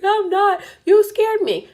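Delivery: loud, high mock crying; crying child. Crying six-year-old blames garage man, loud high mock wail. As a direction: imitate an upset kid wailing in protest. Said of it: "Now she's crying".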